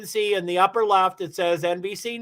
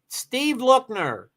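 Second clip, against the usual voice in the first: ordinary delivery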